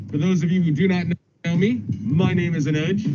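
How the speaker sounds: ordinary delivery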